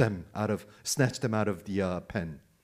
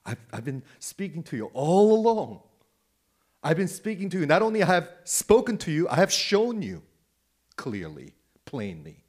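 loud, high-pitched insistent protest; raised, exasperated preaching quote; speaking plainly; 4 semitones higher, 5 dB louder than usual. Retold or imitated. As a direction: protest forcefully as Jesus to doubters. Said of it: "Let me speak to you plainly".

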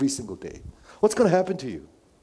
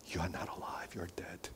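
whispered, much softer warning; hushed; 5 semitones lower, 16 dB quieter than usual. Real, not acted. Pastor whispering a warning to the church. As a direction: breathe it out like a grave secret. Sermon, grave warning dropped low.

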